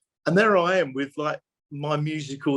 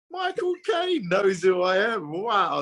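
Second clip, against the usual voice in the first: slow, high-pitched amazed exclamation; mock awed cry; starstruck; 6 semitones higher, slower than usual. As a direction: relive the thrill of being recognised by your hero. Retelling his starstruck reaction.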